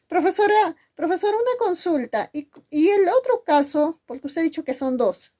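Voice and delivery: high-pitched imitation of a student; pupil with a question. Teacher voices pupil asking question. (imitando a un alumno con voz aguda)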